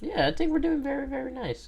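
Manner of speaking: squeaky falsetto praise; high silly voice. Pretend.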